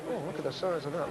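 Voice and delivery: soft, self-absorbed marvelling; muttered aside. Acted. Burger server examining his zit mid-order, muttered aside. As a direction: drop it under your breath.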